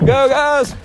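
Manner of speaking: high call out to people outside; cheering call. Real, not acted. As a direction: shout encouragement while passing. Cheering dancers while running past.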